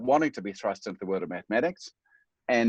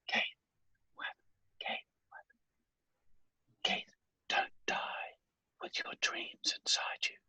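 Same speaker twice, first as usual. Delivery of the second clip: dying whisper; 11 dB quieter than usual. Retold or imitated. Dying mother's final words to her son, faint whisper. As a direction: breathe each word out with the last strength. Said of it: "And she said".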